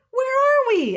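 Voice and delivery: high, loud storybook question; startled cow asking where they are; bewildered question. A narrator's reading. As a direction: call it out, high and lost. Lost character asks after the crash.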